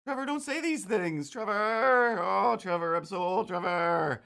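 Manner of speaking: high hammed-up whimpering plea; mock crying. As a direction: beg Trevor in a fake tearful whine. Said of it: "I was tempted to write".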